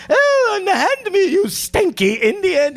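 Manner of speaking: high, posh mock British colonial; hammed-up imperial British voice; British imperial accent. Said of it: "I did some British voice, some imperial".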